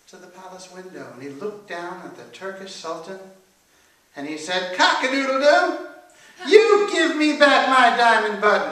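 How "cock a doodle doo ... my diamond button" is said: high, loud rooster crow; loud, high-pitched rooster character; crowing angry rooster. A narrator's reading. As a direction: crow it out as an indignant demand. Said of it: "he was angry".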